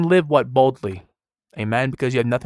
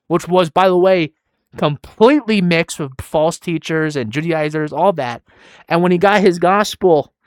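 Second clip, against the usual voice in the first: louder, higher, driving sermon voice; full-force preaching; 5 semitones higher, 5 dB louder than usual. Acted. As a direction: preach it with rising passion.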